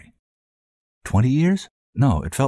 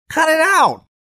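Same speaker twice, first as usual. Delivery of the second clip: high, loud cartoon cry of protest; comedy cartoon voice; 6 dB louder than usual. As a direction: voice outside the door telling him to stop. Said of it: "somewhere between Michael Jackson and a Mel Blanc cartoon character".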